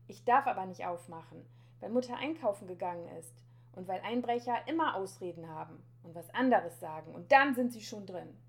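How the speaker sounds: put-on loud, emphatic child's argument; calling out. A narrator's reading. Boy arguing that burglars always have excuses, calling out. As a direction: insist loudly through the closed door. Boy refuses stranger through door.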